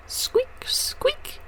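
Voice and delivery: very high, tiny squeaks; mouse squeak. Acted. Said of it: "said a little mouse".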